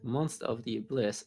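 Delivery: ordinary talk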